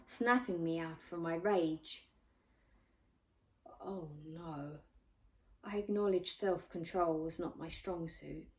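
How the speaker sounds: plain reading voice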